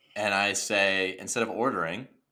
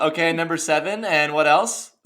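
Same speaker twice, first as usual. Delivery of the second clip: cheery order taker; 7 semitones higher, 6 dB louder than usual. Pretend. Drive-thru worker voice.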